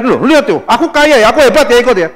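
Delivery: loud, high, bragging sales pitch; boastful false teacher. Retold or imitated. A boastful fake guru luring followers, loud bragging pitch. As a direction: ham up a teacher luring followers with his wealth. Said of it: "Ngomongnya tuh kebenaran, bukan".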